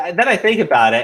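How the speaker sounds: usual speaking voice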